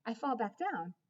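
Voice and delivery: plain reading voice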